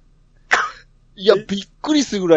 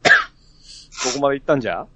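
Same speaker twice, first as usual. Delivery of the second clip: first careful go at Hiroshima dialect; 5 semitones lower, 4 dB quieter, much faster than usual. Shown as a demonstration. Asked by cohost to ask in Hiroshima dialect, tentative Hiroshima-ben. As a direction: try Hiroshima-ben on request, a bit lower. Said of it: "広島弁でどこまで行ったんって言ってみて".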